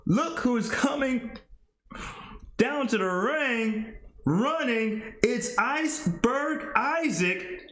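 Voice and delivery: high, excited play-by-play call; hyped ring announcer. Acted. Announcer hyping a wrestler's entrance, excited call. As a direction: sell the big entrance to the crowd.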